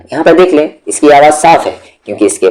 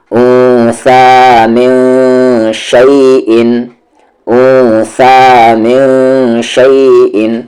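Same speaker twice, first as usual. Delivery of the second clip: very slow, drawn-out tajweed recitation; slow Quranic recitation; 2 semitones higher, much slower than usual. Shown as a demonstration. Teacher recites the joined words slowly for students. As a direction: chant each syllable out slowly.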